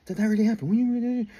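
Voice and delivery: lightly voiced amazed classmates; kids in disbelief. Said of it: "kids going".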